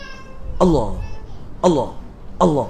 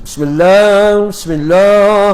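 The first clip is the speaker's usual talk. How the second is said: loud recitation; 4 semitones higher, 4 dB louder, slower than usual. Retold or imitated. Acting worshipper reciting aloud.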